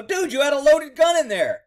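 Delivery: high, loud challenge; incredulous retold confrontation. Cop to suspect about the loaded gun, incredulous.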